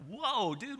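high-pitched, taken aback; mock startled protest. Preacher to a friend who kicked his shin, mock startled protest. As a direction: react as if just kicked, pitch jumping up.

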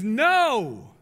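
loud, sharp no; defiant refusal. Acted. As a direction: shut his father down. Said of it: "And the older son says".

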